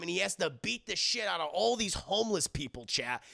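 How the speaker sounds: normal talking voice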